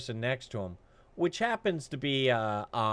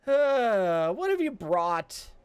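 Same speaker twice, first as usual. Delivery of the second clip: high, slow, taunting guard voice; mocking guard; 9 semitones higher, 4 dB louder, slower than usual. Guard stonewalling Jonas at the gate, high and taunting. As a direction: stall him with fake ignorance.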